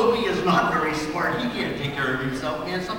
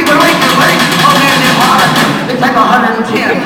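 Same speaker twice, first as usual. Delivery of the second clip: loud, higher hammed-up heat complaint; shouting over music; 7 semitones higher, 14 dB louder than usual.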